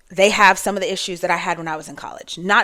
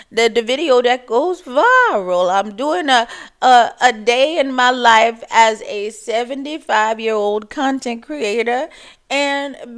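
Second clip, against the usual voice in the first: a higher old-lady voice, chatty and distracted; 4 semitones higher than usual. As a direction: play a scattered seventy-five-year-old mother. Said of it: "seventy-five-year-olds that talk like that".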